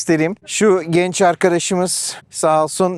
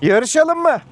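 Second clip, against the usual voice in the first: playful challenge; 9 semitones higher than usual. Real, not acted. Teasing ATVs to race.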